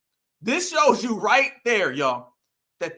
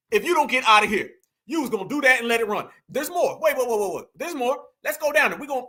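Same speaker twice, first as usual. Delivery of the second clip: ordinary talk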